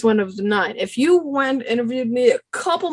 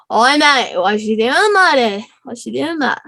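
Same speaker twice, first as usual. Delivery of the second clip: child's attempt at an Australian accent; put-on Australian greeting; 3 dB louder, slower than usual. Shown as a demonstration. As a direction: have a cheeky go at Aussie. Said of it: "I can do an Australian accent".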